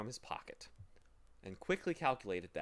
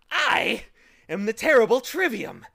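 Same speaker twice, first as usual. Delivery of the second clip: high, booming villain's self-introduction; triumphant shriek; 8 semitones higher, 15 dB louder than usual. A narrator's reading. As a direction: proclaim your dreadful titles in triumph. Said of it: "he shrieked triumphantly".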